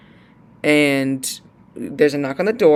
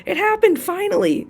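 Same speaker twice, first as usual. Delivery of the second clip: excited squeal; slower than usual. Retold or imitated. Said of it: "I'm like".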